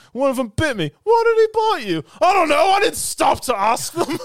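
loud, high acted bickering; exasperated shouting. Retelling housemates arguing about the bitten hand, high exasperated shouting. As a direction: escalate to an exasperated yell. Acting heated exchange about bite.

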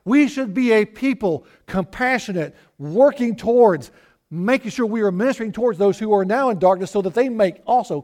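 loud, ringing pulpit delivery; preaching at full force. Acted. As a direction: preach it out to the whole congregation. Preaching to the congregation.

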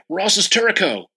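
Turco called out high and slow; yelled out. Retold or imitated. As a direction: call "Turco" out high and drawn out, two syllables. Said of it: "I yelled out".

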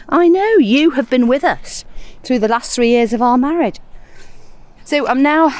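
breathless from digging; out of breath. Real, not acted. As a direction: puff through the words while digging. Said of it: "sorry, I'm a little out of breath".

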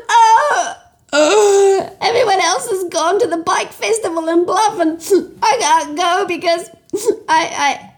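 high, whiny stammering complaint; whiny upset girl. Acted. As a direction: stammer it out, near tears. Bikerella sobs her woes.

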